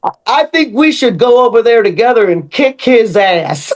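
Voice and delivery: put-on laughing through a Southern accent; an old Southern karate man suggesting a fight, half-laughing; imitating an old friend in a Southern accent while laughing. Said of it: "they have their Southern accent".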